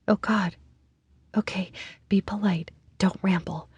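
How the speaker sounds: whispered nervous inner thoughts; a whispered pep talk to herself; anxious whisper. A narrator's reading. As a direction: hush it to yourself, scared.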